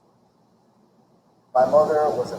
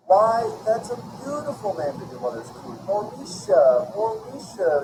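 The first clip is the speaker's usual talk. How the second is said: cooing; 8 semitones higher than usual. Acted. New mothers cooing the baby's name into the blanket. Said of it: "they cooed into the blanket".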